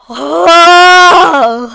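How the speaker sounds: long, loud frustrated "aah"; frustrated yell. Real, not acted. Streamer despairing at the falling count, frustrated yell. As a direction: let out a drawn-out yell of frustration.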